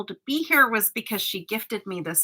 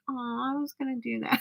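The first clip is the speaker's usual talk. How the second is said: soft, higher little pout; mock disappointment; 4 semitones higher, 7 dB quieter than usual. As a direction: whine it quietly, half joking. Acts own disappointed reaction.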